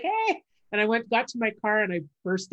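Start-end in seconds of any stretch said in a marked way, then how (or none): 0.0-0.4 very high, held "hey" back; high answering greeting. Retold or imitated. Comic to fans outside the club, high-pitched greeting. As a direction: return the cheer in a squeaky high voice. Imitates own reply to fans.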